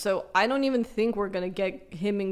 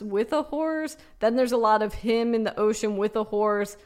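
ordinary talk, nothing marked

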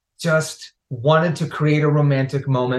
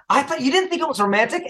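incredulous; 10 semitones higher, much faster than usual. Real, not acted. Incredulous at cohost's opinion.

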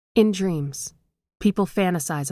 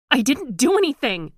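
high, loud cry that cracks; shouted, voice cracking; 9 semitones higher, 5 dB louder than usual. A narrator's reading. Daughter denying guilt to her father, cracking shout. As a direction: yell it as your voice breaks. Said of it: "Gale's voice cracked as she shouted".

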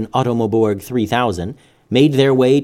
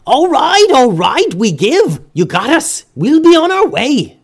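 high, loud pleading pirate voice; 6 dB louder than usual. A narrator's reading. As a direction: beg for mercy, high and loud. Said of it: "the pirate pleaded".

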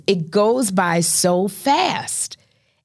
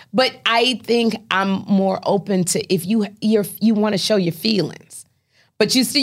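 ordinary talk

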